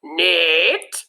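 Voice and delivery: usual speaking voice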